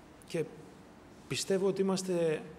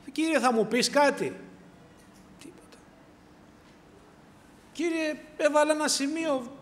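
higher and louder, begging for a sign; desperate higher-pitched plea for a word from God; pleading to God; 8 semitones higher, 7 dB louder than usual. Pretend. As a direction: cry out to God for a message.

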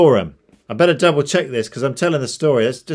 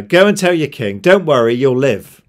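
raised, higher voice, confidently announced; loud reassurance; 3 semitones higher, 8 dB louder than usual. Acted. Prophet giving the servant a message for the king, loud reassurance. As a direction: call it out as an emphatic reassurance.

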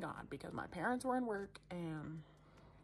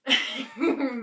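big loud laugh; loud burst of laughter; 5 semitones higher, 15 dB louder than usual. Real, not acted. Laughs at own story.

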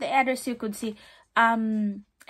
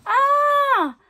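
excited gasp; 14 semitones higher, 9 dB louder than usual. Real, not acted. Thrilled by the dust bag.

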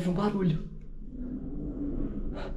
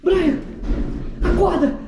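urgent calling; 11 semitones higher, slower than usual. Real, not acted. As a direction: shout to wake him up. Waking friend, hearing noise.

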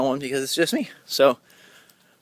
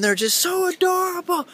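gushing adoration. Real, not acted. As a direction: stretch it out in a high gush. Gushing over puppies.

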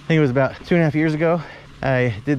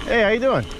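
Mister Bonelli greeting; 6 semitones higher than usual. Acted. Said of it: "You summon Mister Bonelli".

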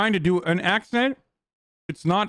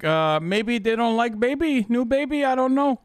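higher-pitched clueless mock voice; oblivious airhead; 5 semitones higher than usual. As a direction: imitate someone blissfully unaware, pitch climbing. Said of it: "completely oblivious to, like, everything".

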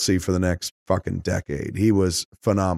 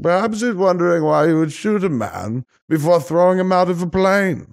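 put-on higher, refined old Englishman, unhurried; posh old British man; 9 semitones higher, 3 dB louder than usual. Podcaster imitating the villain's posh British voice, refined and higher. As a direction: purr it like a genteel British villain. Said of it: "He sounds like this posh old British man".

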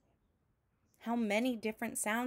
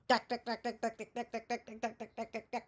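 rapid percussive duck-duck imitating a jackhammer, fading softer; jackhammer noise; 3 dB quieter, faster than usual. A narrator's reading. Reading to a child, imitating the street jackhammer. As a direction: hammer it out like a jackhammer.

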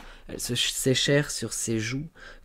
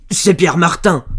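loud, high accusing shout; angry guess; 8 semitones higher, 14 dB louder than usual. A narrator's reading. Farmer naming another suspected lover, angrily. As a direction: throw the name at her.

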